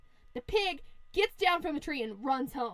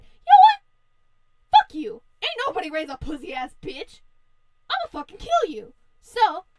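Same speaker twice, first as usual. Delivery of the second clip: acted angry outburst, higher and louder; pissed-off wolf; 4 semitones higher, 9 dB louder than usual. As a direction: rant and threaten as the enraged wolf. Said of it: "that pisses off the wolf".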